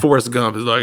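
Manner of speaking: normal talking voice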